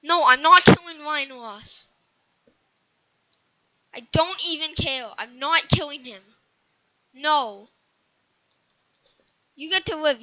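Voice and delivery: loud, insistent refusal; frustrated shouting. Real, not acted. Gamer shouting his refusal to kill [name]. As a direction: hammer every no loudly.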